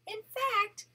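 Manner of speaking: gentle read-aloud storytelling voice; storybook narration for a grandchild; story narration. A narrator's reading. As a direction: read it like a bedtime picture book. Grandma reads picture book aloud.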